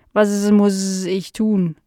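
slow housefly with drawn-out buzzing s sounds; curious housefly. Acted. Fly meeting Nala for the first time, slow and buzzy. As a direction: stretch the words like a dopey, curious fly.

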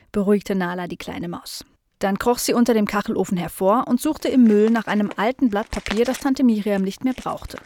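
ordinary delivery

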